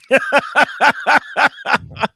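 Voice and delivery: loud, high-pitched run of laughter; real belly laugh in quick bursts; hearty laugh. Real, not acted. Reacting to a tough viewer question, hearty laugh.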